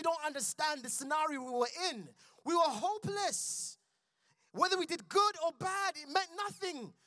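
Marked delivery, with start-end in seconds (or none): 2.4-6.9 impassioned preaching. Real, not acted. Preaching at full force.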